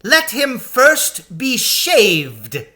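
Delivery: high, slow, gloating order; cruel witch. A narrator's reading. Witch ordering Aslan shaved, high and slow. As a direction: savour each word cruelly. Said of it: "said the witch".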